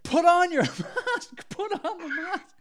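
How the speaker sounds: pleading through laughter, desperate for him to comply; frantic urging. Retold or imitated. Narrator silently begging the maskless guy, frantic laughing plea.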